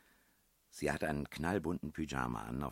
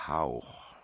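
whispered puzzle word on a strong breath; 5 dB louder than usual. A narrator's reading. Princess reading out the clue for breath, whispered. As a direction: breathe the word out as a voiceless puff.

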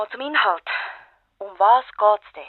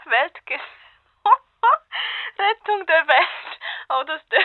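laughing through the words, high and louder; can barely get the title out for laughing; 9 semitones higher, 5 dB louder, slower than usual. Acted. Reporter cracks up repeating the author's grand book title, high and laughing.

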